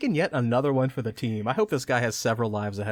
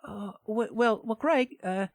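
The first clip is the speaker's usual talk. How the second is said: higher put-on Lara voice; pretend Lara; 11 semitones higher than usual. Lara argues with Greg, higher put-on voice. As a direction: coax him in a high girlish voice.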